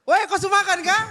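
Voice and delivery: high, punchy NTT-style question; eastern Indonesian intonation. Shown as a demonstration. Demonstrating to the hosts how people ask back home, high-pitched eastern intonation. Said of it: "kayak kita kayak dibentak".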